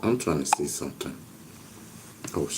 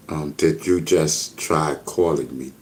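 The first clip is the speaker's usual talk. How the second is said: ordinary talk, nothing marked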